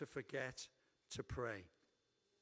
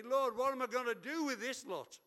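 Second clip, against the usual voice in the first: higher, louder fed-up plea; exasperated Moses; 7 semitones higher, 7 dB louder than usual. Pretend. Moses crying out to the Lord about the people, exasperated and loud. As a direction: at the end of his rope with this lot. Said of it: "he does say".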